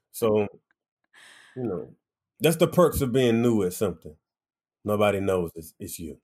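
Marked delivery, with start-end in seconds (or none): none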